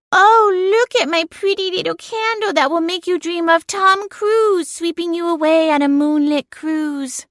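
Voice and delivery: high, sugary mock of the narrator; mocking Cora impression. Cat mocking her owner Cora in a high sugary voice. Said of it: "I don't sound like that".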